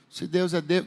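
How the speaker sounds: soft, understated quote; mild, low-key Elijah. Shown as a demonstration. Preacher showing how Elijah would not have said it, soft and mild. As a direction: say it softly, with no force behind it. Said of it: "não vejo Elias dizendo isso assim".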